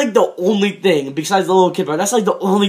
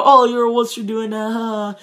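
higher-pitched playground jeer; mock taunt; 5 semitones higher than usual. Reviewer acting out how shows mock someone backing down, mock taunting. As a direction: tease like a cartoon heckler, pitched up.

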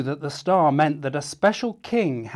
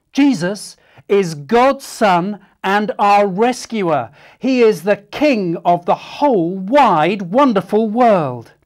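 loud, higher declaration; joyful announcement; proud proclamation; 6 semitones higher, 9 dB louder than usual. Shown as a demonstration. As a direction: proclaim it boldly. Proclaiming the message.

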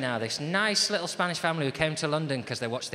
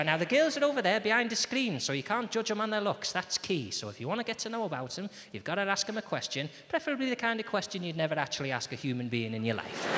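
higher-pitched dating show hostess; 6 semitones higher than usual. Acted. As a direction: explain the rules like a TV hostess. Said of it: "you'd have Scylla come out".

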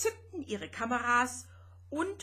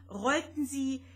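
ordinary delivery, nothing marked

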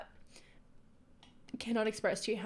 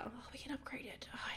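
soft unvoiced whisper to herself; 3 semitones higher, 11 dB quieter, faster than usual. Real, not acted. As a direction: whisper it like a private thought. Muttering to herself while building.

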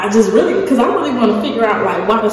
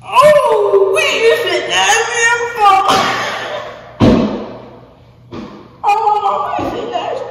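disgusted; 14 semitones higher, slower than usual. Real, not acted. Tasting a banana he hates.

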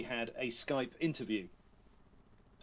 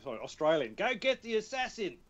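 put-on Australian accent; 7 semitones higher than usual. Quoting his Road Redemption character to the hosts, high Australian accent. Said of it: "you know the Australian says".

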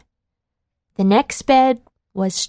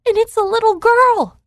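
put-on baby bear crying out; 8 semitones higher, faster than usual. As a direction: build from upset to an alarmed squeal. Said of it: "cried the baby bear".

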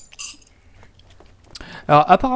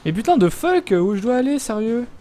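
frustrated; 6 semitones higher, 4 dB louder than usual. Real, not acted. Frustrated, lost in game.

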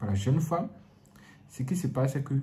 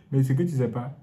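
ordinary delivery

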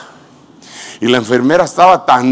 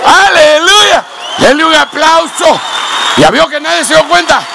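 preaching at full force; 10 semitones higher, slower than usual. Acted. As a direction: lift the crowd with a shouted praise. (predicando a todo pulmón)

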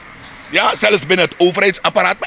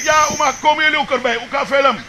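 lightly acted, high-pitched quote; mimicked bystander; 7 semitones higher than usual. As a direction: imitate someone calling grandma over, pitched well up. Mimics people talking at the site.

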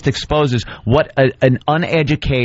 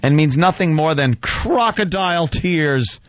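mock dramatic reading; 4 semitones higher, slower than usual. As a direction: declaim it with heavy emphasis and pauses. Reads the writer's piece aloud.

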